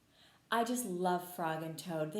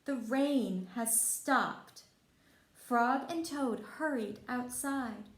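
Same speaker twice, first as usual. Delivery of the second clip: bright, lively storybook reading, louder and higher; reading to children; 3 semitones higher, 5 dB louder than usual. A narrator's reading. Teacher reading Frog and Toad to kids, lively storybook voice. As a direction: read it out like a teacher at story time. Reads picture book to children.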